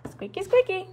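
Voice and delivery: high, playful squeak. Real, not acted. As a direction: squeak it out like the stairs. Mimics the squeaky stairs.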